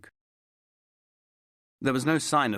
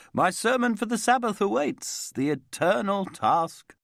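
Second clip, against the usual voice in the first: rabbi Gideon; 12 semitones higher, 4 dB louder than usual. A narrator's reading. Rabbi Nathan Gideon excusing himself.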